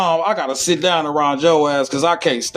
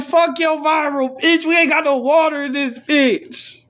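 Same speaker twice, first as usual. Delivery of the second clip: mock angry future people; 12 semitones higher than usual. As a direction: snap back as thirsty future people. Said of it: "They're like".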